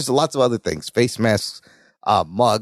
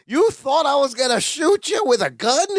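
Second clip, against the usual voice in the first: incredulous Vince; 9 semitones higher than usual. Retold or imitated. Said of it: "And Vince still is not over".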